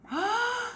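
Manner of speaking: sharp high gasp in; demo gasp for air. Shown as a demonstration. Swim coach shows the breath, sharp gasp. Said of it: "Take a breath above the water".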